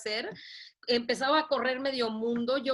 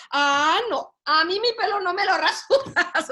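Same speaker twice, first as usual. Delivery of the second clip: loud laughing refusal, higher; laughing protest; 8 semitones higher, 8 dB louder than usual. Retold or imitated. Patient refuses to let the doctor shave her hair, laughing protest. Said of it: "y yo".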